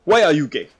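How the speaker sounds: mock yell of a meme line; loud blurt. Blurted meme line then apologizes.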